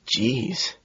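soft, hushed and gentle; a soft surprised hush; gentle whisper. A narrator's reading. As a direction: breathe it out gently, almost tender. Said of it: "Price whispers in a surprisingly gentle way".

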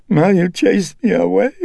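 put-on high, pleading old mother's voice. A narrator's reading. Mother pleading with her son, high and sorrowful. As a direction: beg him like a hurt old mother.

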